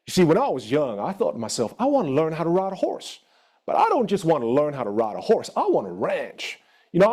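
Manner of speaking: wistful would-be rancher with a Texan twang; Texan accent. Shown as a demonstration. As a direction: put on a broad Texan accent. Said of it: "Texan accent".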